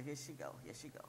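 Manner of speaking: ordinary talk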